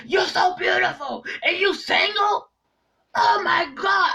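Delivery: imitating him with a high mock shout; angry suitor. Retelling a jealous man's outburst to viewers, high mock shout. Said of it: "And he was angry about it".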